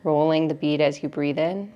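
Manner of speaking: measured breathing cues, stretched out with long pauses; guided meditation. Real, not acted.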